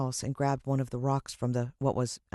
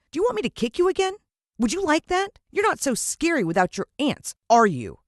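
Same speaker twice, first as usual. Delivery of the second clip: put-on much higher, fast, louder boyish jeering; brave taunting boy; 12 semitones higher, 6 dB louder than usual. The boy dares Mister Motcher, high, fast and brave. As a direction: goad him like a cocky kid. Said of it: "feeling brave all of a sudden".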